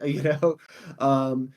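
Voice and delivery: ordinary delivery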